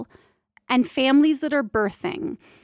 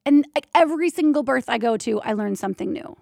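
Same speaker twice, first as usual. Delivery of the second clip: ordinary talk, nothing marked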